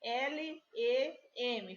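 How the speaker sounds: slow spelled letters L E M; name each letter L, E, M slowly; spelling letters. Shown as a demonstration. Teacher reads scrambled letters to pupils, slow and clear. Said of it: "forma qual palavra?".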